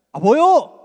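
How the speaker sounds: loud, high mock-angry Chungcheong retort; angry Chungcheong dialect. Shown as a demonstration. Pastor turned Chungcheong speaker when mad, angry Chungcheong dialect. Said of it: "화가 나면".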